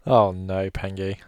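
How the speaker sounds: ordinary delivery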